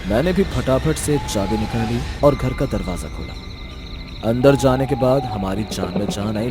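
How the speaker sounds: ordinary delivery, nothing marked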